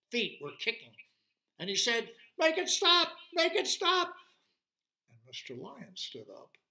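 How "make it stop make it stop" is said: high, loud T-Rex wail; desperate pleading. Acted. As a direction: beg loudly for relief, pitched way up. T-Rex begs as screaming torments him.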